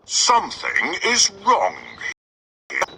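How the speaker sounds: Father Bear sensing trouble. Acted. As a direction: sense something is off.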